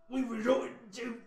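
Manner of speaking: put-on an old hunched lizard speaking with weight. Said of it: "voices I'm doing with the same accent".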